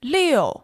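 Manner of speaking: Liu falling sharply from high; fourth tone. Shown as a demonstration. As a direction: snap Liu straight down. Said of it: "fourth tone".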